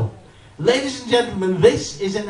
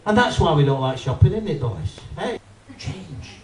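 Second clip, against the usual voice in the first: normal talking voice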